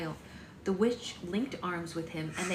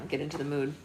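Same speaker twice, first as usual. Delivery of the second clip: usual speaking voice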